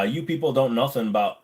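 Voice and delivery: normal talking voice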